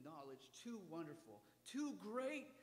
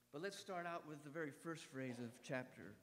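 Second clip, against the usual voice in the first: normal talking voice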